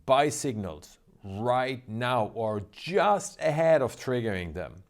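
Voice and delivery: normal talking voice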